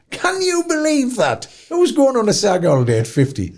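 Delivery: incredulous. Real, not acted. Incredulous about Saga age limit.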